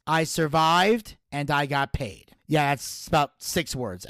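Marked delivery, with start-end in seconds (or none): 0.1-2.3 slow declaration. Shown as a demonstration. Comic quoting his own five words to listeners, slow and weighty. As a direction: announce it like a hard-won motto. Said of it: "But here it is".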